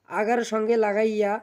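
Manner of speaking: ordinary talk, nothing marked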